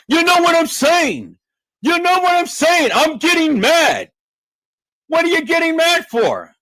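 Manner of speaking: shouting down the imitators in a high, loud rant. Shown as a demonstration. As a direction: crank the pitch up high and hammer every line. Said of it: "when I want to put emphasis upon things, now my voice raises".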